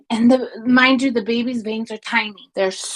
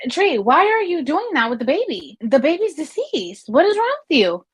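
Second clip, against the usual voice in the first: higher, incredulous questioning; retold disbelief; 7 semitones higher than usual. Scolding coworker for rocking dead baby, incredulous. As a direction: demand it in shocked disbelief. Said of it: "And I said".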